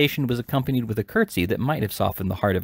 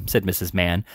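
plain reading voice

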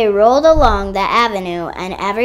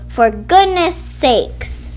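exasperated; 6 semitones higher, 4 dB louder, much slower than usual. A narrator's reading. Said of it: "yelled Blue".

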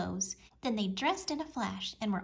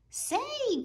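much higher goofy voice; Goofy cry. A narrator's reading. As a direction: cry out in a silly high Goofy voice. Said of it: "Goofy cried".

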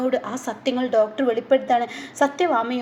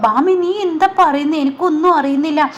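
higher, bewildered asking; surprised question; 4 semitones higher, 6 dB louder than usual. Retold or imitated. Raghavan asking Bhama, caught off guard, in surprise. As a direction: sound thrown by what she says.

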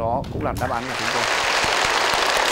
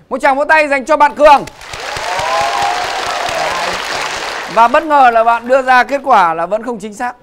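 raised, loud hype; excited host; 7 semitones higher, 8 dB louder than usual. Real, not acted. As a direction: whip up the applause. Host hypes crowd for applause.

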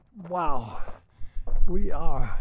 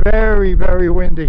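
shouting over wind; 12 dB louder than usual. Real, not acted.

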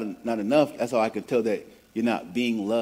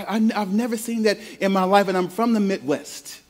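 ordinary delivery

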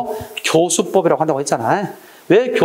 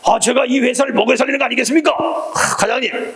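high-pitched, hammed-up self-praise; mock cocky employee, pitched up; immodest boasting; 6 semitones higher than usual. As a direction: brag to the boss in an acted voice. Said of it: "겸손하지 못한 거죠".

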